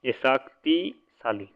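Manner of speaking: शक्तिशाली said slowly, each syllable drawn out; शक्तिशाली spelled out slowly for the class; slow emphasis. Shown as a demonstration. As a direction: stretch out शक्तिशाली to stress it. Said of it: "ये important है".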